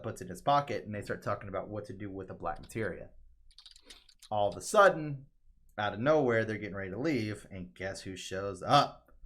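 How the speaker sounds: usual speaking voice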